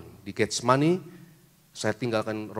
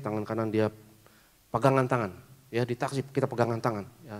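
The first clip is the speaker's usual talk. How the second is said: ordinary talk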